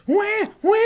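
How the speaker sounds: high mock infant wail of wah; mock baby crying. Imitating BB crying after the player falls. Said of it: "the B B's like".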